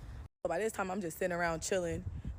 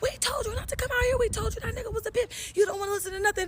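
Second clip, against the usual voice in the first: much higher mock scolding voice; scolding family; 5 dB louder, faster than usual. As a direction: nag like the cousins in the car. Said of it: "They all just look at me and say".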